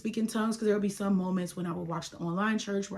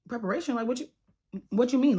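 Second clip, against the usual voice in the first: high, rising, disbelieving question; incredulous; 5 semitones higher, 4 dB louder, faster than usual. Retold or imitated. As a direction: echo the word back in disbelief. Incredulous echo of pastor.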